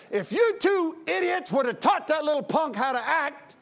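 pastor telling off the parents, heated; angry retort. Retold or imitated. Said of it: "But then I said".

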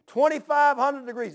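high, loud imitated shout; retold yell. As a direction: yell it out like a worker over a roaring furnace. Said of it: "he's yelling at me".

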